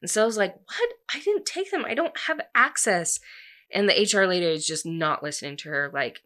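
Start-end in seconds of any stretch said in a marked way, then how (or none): 0.6-3.2 high-pitched, alarmed denial; acted squeak of disbelief; shocked protest. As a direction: imitate someone wrongly accused, voice shooting up. Accused employee protests in story.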